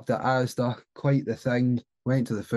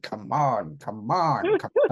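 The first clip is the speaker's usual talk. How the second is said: Carney imitation; slower than usual. Driver slapping the dash of his dead car, imitated urging. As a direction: urge the car on, last one squeaking up. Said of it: "That's what it sounds like as well".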